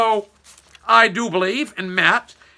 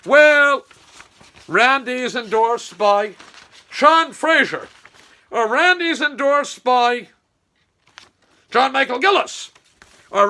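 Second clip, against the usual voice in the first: higher, louder mocking quotes; mocking endorsements; 5 semitones higher, 6 dB louder than usual. Mocking Randy's endorsement posts in a higher, louder sarcastic voice. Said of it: "I've seen".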